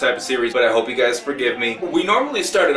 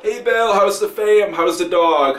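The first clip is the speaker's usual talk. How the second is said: higher, louder friendly chatter; mock-cheery salon welcome; chummy hairdressers; 6 semitones higher, 4 dB louder, slower than usual. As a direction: greet him like an old pal. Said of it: "everybody knows his name".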